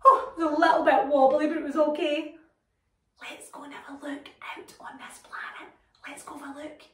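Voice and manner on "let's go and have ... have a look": hushed whisper, voice off. Pretend. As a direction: whisper it like sneaking out. Sneaking out onto the planet.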